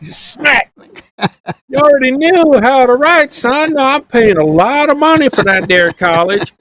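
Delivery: high-pitched hillbilly father scolding his son; dad back from down south demanding what his college son learned, in a high hillbilly voice; Jed Clampett dad. Retold or imitated. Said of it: "you sounded like there was Jed Clampett and Jethro".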